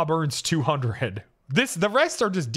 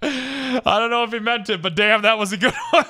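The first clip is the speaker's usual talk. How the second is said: high, loud laughing through the words; cracking up while praising the joke; 7 semitones higher, 3 dB louder than usual. Real, not acted. Laughing at the cop's comeback.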